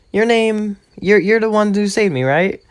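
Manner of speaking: a hint of an eager schoolgirl; girly voice. Uraraka voiced, girl meeting Deku.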